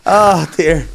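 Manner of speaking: ordinary talk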